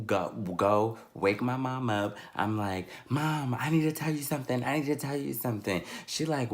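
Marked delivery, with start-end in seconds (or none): 3.1-5.8 quick, insistent plea to Mom; urgent child. Retold or imitated. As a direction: rattle it off urgently, imitating a kid. Child urgently waking sleeping mother.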